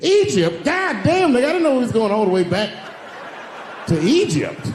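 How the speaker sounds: high, loud disbelief; incredulous. Pretend. Comic losing the suffering game to an Egypt mention, loud and high. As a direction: throw up your hands in disbelief.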